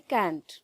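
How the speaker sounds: can't said short with a clear t; contracted can't. Shown as a demonstration. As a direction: snap cannot into can't. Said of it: "इसको pronounce करते हैं".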